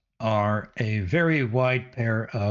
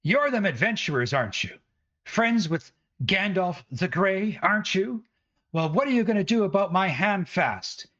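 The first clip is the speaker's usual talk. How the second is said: much higher, fast scolding voice; broom-waving woman berating the adventurers, high and shrill-voiced scolding; angry village woman; 6 semitones higher than usual. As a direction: scold them like an irate housewife with a broom. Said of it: "and she says".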